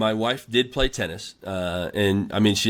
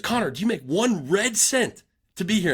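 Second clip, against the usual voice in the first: high, pitched-up imitation of a furious coach; angry coach rant; 11 semitones higher than usual. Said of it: "he was pissed off at the team".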